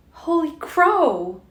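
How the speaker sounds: high, loud, slow gasp of alarm; frightened gasp. A narrator's reading. Bella realising how fast he drives, gasping high. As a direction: shriek it out in sudden fear. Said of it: "she gasped".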